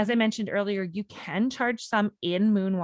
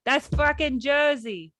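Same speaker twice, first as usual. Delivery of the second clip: drawn-out mock Jersey accent, pitched up; slow, exaggerated Jersey; 5 semitones higher, slower than usual. Deciding which accent it is, hammed-up Jersey. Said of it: "Is that, like, Jersey?".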